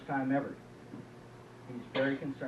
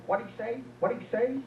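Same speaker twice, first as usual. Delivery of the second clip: high-pitched, querulous repeated question; raised pitch, asking as if she missed it; hard-of-hearing wife; 7 semitones higher than usual. Acted. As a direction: pipe up as a wife who can't hear. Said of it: "the wife's kinda hard of hearing".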